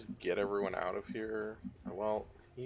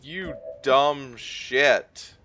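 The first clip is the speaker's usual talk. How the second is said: angry; 6 semitones higher, 13 dB louder, much slower than usual. Real, not acted. Gamer curses at bad move.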